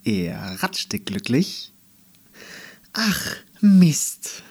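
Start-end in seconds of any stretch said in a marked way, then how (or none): 2.9-4.4 gespielt laut und hoch „Ach, Mist“; extra laut. Pretend. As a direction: absichtlich theatralisch ärgern. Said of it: "sagte sie dann extra laut". (Andi spricht extra laut, damit das Wesen zuhört)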